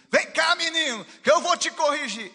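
loud, high scolding shout; furious father shouting; angry yell at child. Retold or imitated. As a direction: bark at the boy to come and be punished. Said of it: "falar pro menino, pro seu filho assim".

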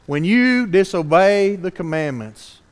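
usual speaking voice

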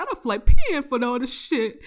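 loud, high mock dismay; shook. Telling mom about the cost of repairs, shook. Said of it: "I was, like, so shook".

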